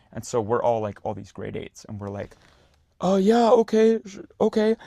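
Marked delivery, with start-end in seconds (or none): 3.0-4.8 high, loud nervous agreement; scared kids. Retold or imitated. Kids spooked by the counselors' warning, nervous high yelps. Grade eights reacting to scary warning.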